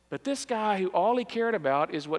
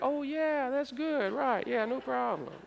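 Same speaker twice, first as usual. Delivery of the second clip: embarrassed cover-up; 6 semitones higher, 4 dB quieter, slower than usual. Retold or imitated. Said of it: "and you're like".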